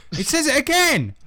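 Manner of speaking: can't believe it's there again; exasperated outburst. Real, not acted. Reading bad fanfic aloud, exasperated high outburst. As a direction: jump way up in pitch, fed up.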